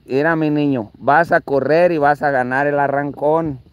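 low, tender pep talk; coaxing the car. Shown as a demonstration. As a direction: soothe it like a little child. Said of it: "Y le dices". (voz baja y cariñosa)

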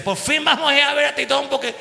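hammed-up Cuban accent longing for Titón. Joking on the plane in a Cuban accent. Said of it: "En el avión empecé".

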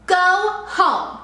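loud high bully shout. A narrator's reading. Sammy drives Kevin off, loud bully shout. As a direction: shout him away. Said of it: "Sammy yelled even louder".

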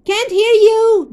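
high, loud yelled reply. A narrator's reading. Dad yelling back that he cannot hear. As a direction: shout it back over the noise. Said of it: "Dad responded".

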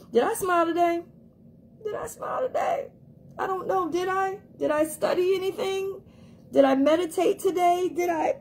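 high, excited self-questioning; hammed-up panic over the morning list; high mock fretting checklist; frantic self-check.

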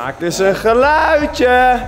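slow, loud, high call. Real, not acted. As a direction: call out to someone hidden. Calling out to hidden friend.

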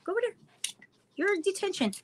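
high mock-stern order to a pet; mock scolding the dog. Real, not acted. Owner sends dog to detention, high mock scold. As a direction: playfully banish the puppy.

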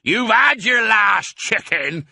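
slow, high, loud threat; drawn-out, angry farmer's shout; yelled. A narrator's reading. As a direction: yell it out at the thief. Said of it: "yelled Boggis".

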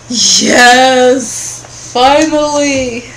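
loud relieved yes; excited cheer. Real, not acted. Finally found save point.

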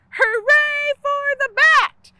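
high, loud witch cheer; shout. A narrator's reading. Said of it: "shouted the witch".

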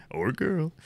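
usual speaking voice

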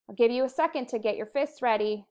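usual speaking voice

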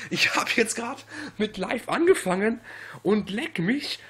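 ordinary talk

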